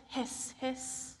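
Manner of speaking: soft kettle hiss. Acted. Kettle sound.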